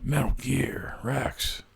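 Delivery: slow hushed whisper for effect; dramatic whisper. Pretend. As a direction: breathe the name like a secret. Ominous reveal of next episode.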